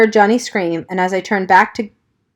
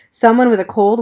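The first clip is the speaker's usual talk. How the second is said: usual speaking voice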